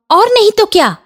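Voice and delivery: very high, huffy comeback; indignant retort. Acted. Aunt retorting to bahu, high indignant retort. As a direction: shoot the pitch up in indignation.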